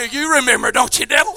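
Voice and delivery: ordinary talk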